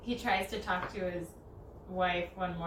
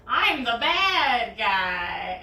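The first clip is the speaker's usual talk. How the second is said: very slow, louder dramatic announcement; hammed-up realization; mock revelation; 3 semitones higher, 11 dB louder, much slower than usual. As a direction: drag it out like a stunned hero. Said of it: "And then he's like".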